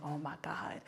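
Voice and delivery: hushed, voiceless disbelief; shocked whisper. Retold or imitated. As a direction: whisper it under your breath, aghast. Shocked at bleeding finger.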